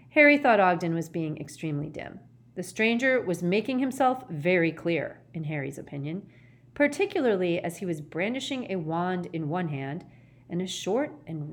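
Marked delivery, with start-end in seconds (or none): none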